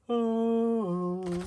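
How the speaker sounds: sudden very high startled oh; a shrill little yelp of surprise; surprised squeal. Real, not acted. Crafting alone, startled by something, high squeal.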